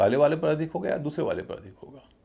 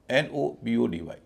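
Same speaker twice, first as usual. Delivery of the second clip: ordinary talk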